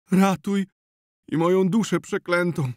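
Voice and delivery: high, loud desperate cry for help; desperate plea. Acted. Zenon begging the healer for rescue, desperate high cry. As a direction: beg him to save them, high and loud. Zenon begs, self-cursing.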